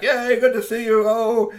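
high, loud happy cheer; excited greeting. Acted. Friend hugging Strongbones, high and loud with joy. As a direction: burst with joy at the reunion.

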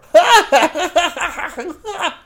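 very high, loud laughing fit; high loud cackle. Acted. Strongbones cackling loudly at his own joke.

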